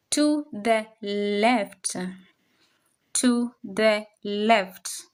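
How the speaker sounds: To the left said slowly, stretched; slow model. Shown as a demonstration. Teacher slowly modelling the English phrase. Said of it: "teacher models phrase".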